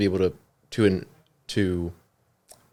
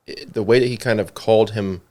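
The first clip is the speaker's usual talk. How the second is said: usual speaking voice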